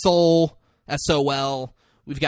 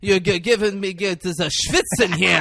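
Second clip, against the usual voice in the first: high, loud, hammed-up Yiddish accent; over-the-top Yiddish kvetch accent; 6 semitones higher, 4 dB louder than usual. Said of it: "Like, over the top".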